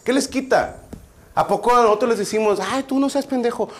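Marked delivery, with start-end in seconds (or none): 0.0-0.8 mock indignation. Host defends the smoking guest, mock indignant. 2.6-3.7 lightly mimicked, higher-pitched reproach; mock scolding. Host mimics criticising the audience, mock scolding.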